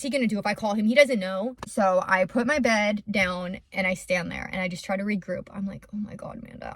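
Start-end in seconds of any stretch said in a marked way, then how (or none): 5.9-6.7 breathless whisper to herself; whispered panic. Retold or imitated. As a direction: whisper it like you've lost your way. Panicked self-talk while lost.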